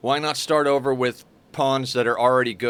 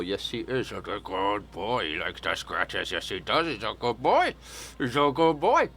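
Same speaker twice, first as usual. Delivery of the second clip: tender pet talk; 4 dB quieter than usual. Real, not acted. Owner scratching his cat, softly cooing good boy. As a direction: gently praise the cat.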